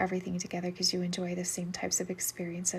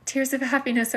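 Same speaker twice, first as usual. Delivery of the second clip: tears of happiness; 6 semitones higher, 9 dB louder than usual. Real, not acted. Relieved reading viewer's reply.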